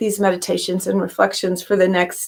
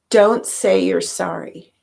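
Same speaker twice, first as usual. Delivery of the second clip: ordinary delivery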